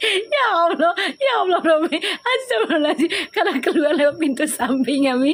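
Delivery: words squeezed out through high laughter; can barely talk for laughing; laughing hard. Real, not acted. Laughing hard at own joke.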